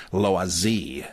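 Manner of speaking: "Loisy" as lwah-ZEE; correct French pronunciation. Shown as a demonstration. Pleading for the right name, "Loisy" as lwah-ZEE. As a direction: end "Loisy" on a stressed ZEE. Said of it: "But at least, please".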